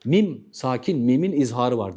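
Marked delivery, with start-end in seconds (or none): none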